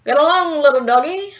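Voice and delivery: playful cowboy singsong; cowboy call. A narrator's reading. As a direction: yodel it out like a ranch hand. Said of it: "Bernie said".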